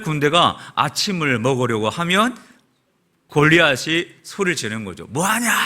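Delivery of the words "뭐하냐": higher, mocking call of "뭐하냐?"; mock giant's yell. Preacher voicing Goliath jeering at Israel's meal, mock yell. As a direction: jeer it up high like a taunting giant. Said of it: "그렇게 소리를 지르는 겁니다".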